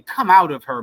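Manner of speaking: a firm call of the Lord's command; lightly voiced divine command; voice of God. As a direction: say it as God calling his people out. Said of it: "That's the voice from God".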